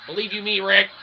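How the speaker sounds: usual speaking voice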